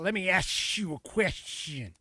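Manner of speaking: mock code-switching accent, slurred on purpose; Jasmine Crockett's put-on accent. Commentator mimics representative talking to voters, mock put-on accent. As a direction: badly imitate a politician's put-on accent. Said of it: "she's gotta pull out the accent".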